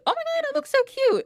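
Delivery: high, quick squeal of delight; excited gushing. Acted. Glossy puts the robe on, excited high gushing. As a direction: squeal it, thrilled with the outfit.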